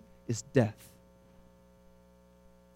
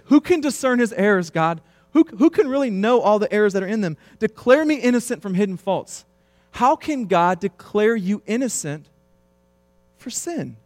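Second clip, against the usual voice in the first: louder, higher preaching voice; fiery preaching; 7 semitones higher, 4 dB louder than usual. Acted. Pastor pressing the congregation on sin, fiery preaching. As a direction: drive the point home to the pews.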